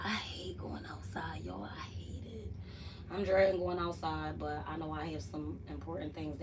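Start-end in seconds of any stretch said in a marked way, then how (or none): 0.0-2.5 hushed whisper, slow; breathy whispered gripe; whispered complaint. Real, not acted. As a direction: whisper your dread of going outside. Dreading chores, hushed aside.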